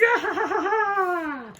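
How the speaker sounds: very high put-on villain laugh; long squealing mock cackle; evil cackle. Villains released to fight, high gloating cackle.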